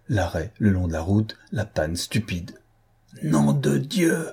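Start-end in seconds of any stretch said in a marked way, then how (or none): 3.2-4.3 slow, higher angry oath; angry yell. A narrator's reading. Don Luis cursing the stalled car, angry yell. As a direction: curse the broken-down car. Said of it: "hurla Don Luis".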